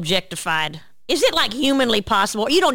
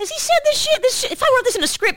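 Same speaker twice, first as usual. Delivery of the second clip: shocked. Real, not acted. Shocked by the story.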